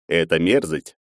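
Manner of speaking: plain reading voice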